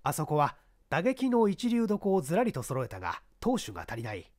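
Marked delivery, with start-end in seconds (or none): none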